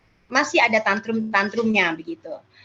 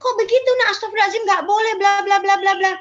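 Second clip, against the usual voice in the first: high, louder mock scolding; scolding bystanders; 9 semitones higher, 3 dB louder, faster than usual. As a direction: play a shocked adult telling the child off. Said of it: "respon orang di sekitarnya adalah".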